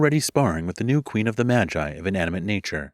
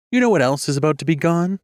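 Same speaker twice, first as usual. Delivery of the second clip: higher, louder, fast retort; shot back; 8 semitones higher, 4 dB louder, faster than usual. Acted. Queen firing back at the music mage. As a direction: fire it straight back. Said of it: "shot back".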